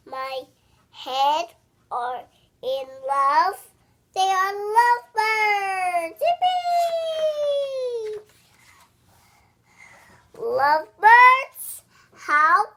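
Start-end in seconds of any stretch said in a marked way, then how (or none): 4.1-8.2 high, gleeful piggy voice; delighted cheer. Acted. Piggie delighted about birds. 10.4-12.6 much louder startled question; shocked disbelief. Acted.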